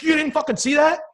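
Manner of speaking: high, loud mock outburst; incredulous yell. Coach to batter who can't see it, incredulous yell.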